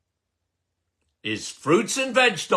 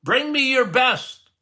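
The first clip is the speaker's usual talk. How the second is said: ordinary delivery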